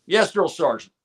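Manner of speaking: crisp recruit reply. Retold or imitated. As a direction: answer like a private at attention. Recruit answering drill sergeant.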